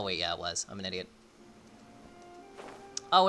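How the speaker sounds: usual speaking voice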